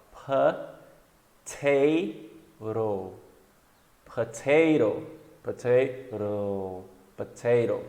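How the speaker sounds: "potato" with schwas, tay stressed, slowed; English potato. Shown as a demonstration. Teacher drilling "potato" for the class, slowly. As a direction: drill "potato" with reduced vowels. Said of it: "batata tem dois schwas".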